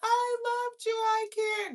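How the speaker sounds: high-pitched fake heartbreak; hammed-up devoted girlfriend, sarcastic; mock lovelorn pleading. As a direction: gush in a put-on high wail. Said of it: "you want me to think that I'm finna be".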